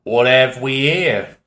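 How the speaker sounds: slow, drawn-out exaggerated Cockney question. Cockney man eyeing the mating pile, slow Cockney. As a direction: lean into a lazy Cockney menace. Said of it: "an exaggerated Cockney voice speaks".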